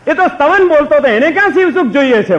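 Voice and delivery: loud mocking laugh through the words; scornful laughing jab; laughing mockery. Real, not acted. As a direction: laugh at the empty excuse. Preacher mocks the devotee, laughing.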